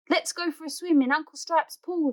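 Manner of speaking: high, eager call; excited yell. Acted. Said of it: "he yells".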